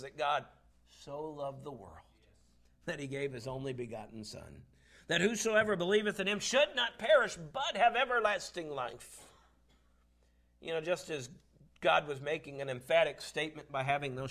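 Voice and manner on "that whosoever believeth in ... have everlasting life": higher, louder, ringing out the verse; forceful scripture recitation. Real, not acted. Preacher proclaiming the gospel verse to church, forceful. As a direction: proclaim the promise to the congregation.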